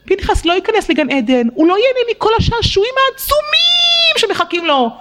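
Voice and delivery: high, pleading voice of the angels; angels' voice. Acted. Angels lamenting over Pinchas in a high voice.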